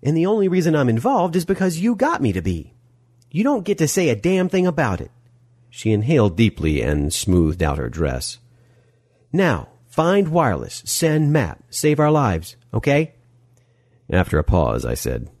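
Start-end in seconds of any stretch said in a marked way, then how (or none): 0.0-5.1 high, indignant scolding; exasperated woman's high-voiced rebuke; snapping. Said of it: "Molly snapped". 9.3-13.1 high, spelled-out orders; pitched-up, insistent checklist; firm instructions. Acted. Molly orders after composing herself.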